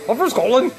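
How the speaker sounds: high-pitched send-up of bad Scottish; mock Scottish accent. Host mimicking coworker's failed attempt, mock Scottish accent. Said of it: "East Coast attempt at a Scottish accent".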